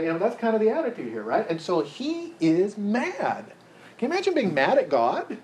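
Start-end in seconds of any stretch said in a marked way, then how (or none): none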